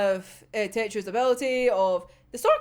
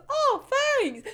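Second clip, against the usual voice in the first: happy thanks. Retold or imitated. Simple happy character quote.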